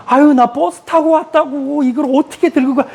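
high pitched mock whine about the bus; protesting daughter-in-law. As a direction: fuss about carrying it home, pitched high.

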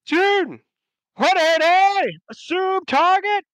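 high, loud parade-ground shout; drill command. Acted. Lieutenant turns his line onto the governor, shouted drill command. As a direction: draw out each order to the troops. Officer orders troops to turn.

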